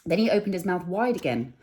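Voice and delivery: ordinary delivery, nothing marked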